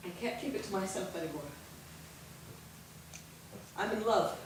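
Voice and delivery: soft, low, intimate confession; hushed confession. Acted. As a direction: confide the secret quietly to her sisters. Sister confesses her secret love.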